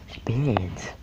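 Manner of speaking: teasing whisper; mock-dramatic whispered tease; whispered suspense. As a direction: breathe it like a secret. Teasing the video's ending.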